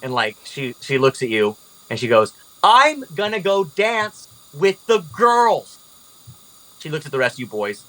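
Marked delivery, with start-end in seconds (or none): 2.6-5.8 high, drawn-out defiant announcement; defiant storming off. Acted. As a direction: announce it slowly, pitched up, as a parting shot. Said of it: "she storms off".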